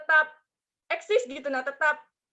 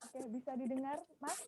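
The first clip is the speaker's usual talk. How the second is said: faint voice, far from the mic; quiet over a weak connection; barely audible; 17 dB quieter than usual. Real, not acted. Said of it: "Kecil sekali".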